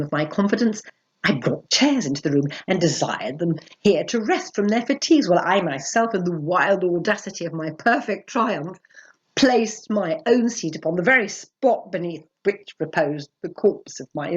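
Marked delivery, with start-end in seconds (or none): none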